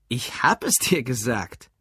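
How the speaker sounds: slow, mildly insistent. A narrator's reading. Alfred answers Lou's accusation, slowly insistent. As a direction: insist on it, drawing the words out. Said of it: "beharrte Alfred".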